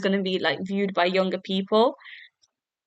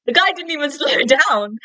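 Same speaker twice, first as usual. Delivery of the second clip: higher, indignant retelling through a laugh; laughing mock outrage; 6 semitones higher, 3 dB louder than usual. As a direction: imitate an outraged driver while amused. Acting out indignant driver, laughing.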